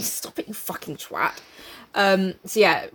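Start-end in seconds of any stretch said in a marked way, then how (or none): 0.0-1.3 whispered mock retort. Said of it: "Can you imagine".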